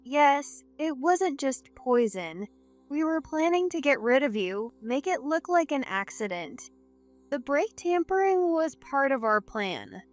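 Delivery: snapping, composure lost. Acted. Cornered wife admits the plot against her husband, snapping. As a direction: confess defiantly in a high voice. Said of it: "losing her composure, finally snapped".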